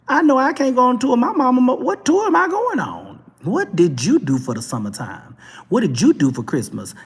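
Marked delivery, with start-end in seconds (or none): 0.1-5.3 high, loud, fast comic disbelief; mock indignant outburst. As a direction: exclaim in exaggerated disbelief at your own lack. Indignant self-comparison with Blue Ivy.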